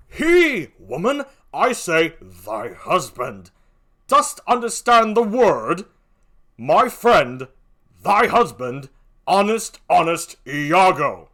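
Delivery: slow, loud, emphatic declamation; Othello, exasperated. Acted. Othello makes Emilia understand, exasperated and forceful. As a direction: punch out each phrase separately.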